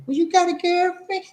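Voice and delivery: high falsetto, prying aunt; high-pitched questioning auntie; nosy auntie. Acted. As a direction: quiz him in a high auntie voice. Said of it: "my auntie".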